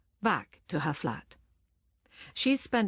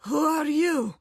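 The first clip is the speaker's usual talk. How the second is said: put-on high, frail old woman's quaver; 9 semitones higher, 5 dB louder than usual. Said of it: "she says in a quavering voice".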